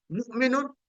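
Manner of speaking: ordinary talk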